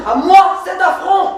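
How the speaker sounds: high, loud cry of affronted pride; indignant lover's outburst; outraged shout. Acted. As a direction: shout as if mortally insulted. Lovers' quarrel, jilted man outraged.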